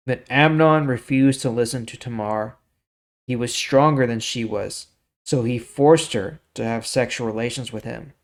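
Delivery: measured storytelling read of scripture; story reading. Acted. Teacher reading the Bible story to viewers, steady narration. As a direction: read the passage like a story to listeners.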